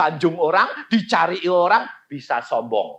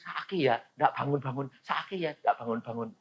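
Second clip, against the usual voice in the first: murmuring doubt; 7 dB quieter than usual. Retold or imitated. Churchgoers doubting to each other, soft murmur. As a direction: mutter it to the person beside you. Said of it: "yang lain bilang".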